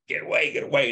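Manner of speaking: high, urgent mock yell; retold scream. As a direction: shout him away in a panic, a bit held back. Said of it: "I'm, like, screaming at him".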